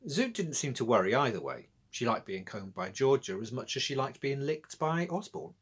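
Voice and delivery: ordinary delivery, nothing marked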